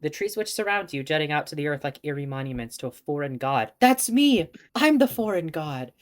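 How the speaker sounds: reading the game text aloud as a story; story narration. Acted. Streamer narrating the novel for viewers, storybook tone.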